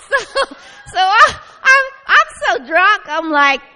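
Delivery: words squeezed out high and loud through real laughter; laughing. Real, not acted. Joking to the congregation she was drunk on joy, laughing hard.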